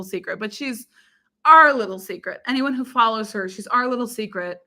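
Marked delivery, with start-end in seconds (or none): none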